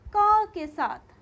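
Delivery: "क के साथ" slow, high and loud; teacher drawing out "क"; stressing the ka sound. Shown as a demonstration. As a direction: lean hard on "क" to let the a ring out. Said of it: "जब हम कहते हैं क".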